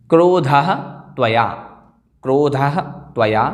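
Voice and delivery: क्रोधः with breathed "ha", pause, then त्वया; teacher separates क्रोधः from त्वया; words split apart. Shown as a demonstration. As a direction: break sandhi, क्रोधः rising, त्वया separate. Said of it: "क्या क्या पद विच्छेद होंगे".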